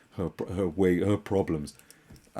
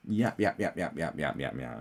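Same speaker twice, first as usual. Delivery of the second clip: ordinary talk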